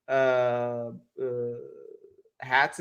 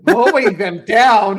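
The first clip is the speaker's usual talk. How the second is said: shocked disbelief; 7 semitones higher, 12 dB louder, slower than usual. Real, not acted. Shocked at misread chat question.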